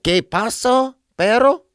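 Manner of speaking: high-pitched Chicano guy; mock local dude, pitched high; New Mexico local.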